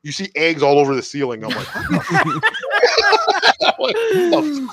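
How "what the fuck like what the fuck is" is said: high-pitched, laughing through the words; laughing disbelief. Retold or imitated. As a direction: crack up in disbelief. Retold finding eggs on ceiling.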